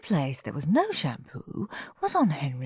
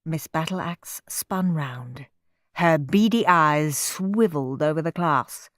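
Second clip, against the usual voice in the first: plain reading voice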